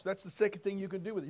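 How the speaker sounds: normal talking voice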